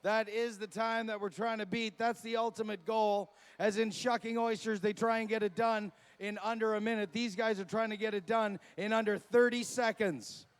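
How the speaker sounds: booming event announcer introductions. Acted. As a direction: sell each name to the crowd. Commentary during race.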